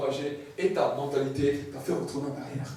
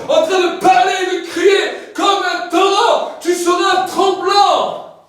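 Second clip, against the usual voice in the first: loud, high booming proclamation; preaching at full force; 13 semitones higher, 14 dB louder than usual. Acted. Preacher describing heaven's throne to the congregation, loud. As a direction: shout the glory of heaven to the crowd.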